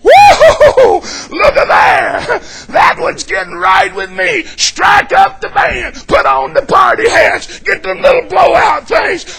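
mock joyous shouted voice of the Lord; God rejoicing. God telling the angels to celebrate, joyful shout. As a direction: throw a heavenly party. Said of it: "The Lord's gonna learn to tell all the angels".

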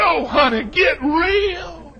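ordinary delivery

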